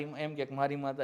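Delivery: ordinary talk, nothing marked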